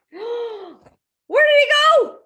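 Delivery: gasp, then high, loud, drawn-out question; shocked wonder. A narrator's reading. Storyteller to children as Kitty vanishes, high astonished question. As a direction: ask it in big mock alarm.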